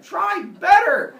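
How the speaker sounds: high, slow, loud mock shout; hammed-up furious scolding; yelling teacher. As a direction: bellow it at a hopeless pupil. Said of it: "yelling at him".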